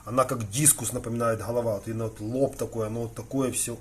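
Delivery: usual speaking voice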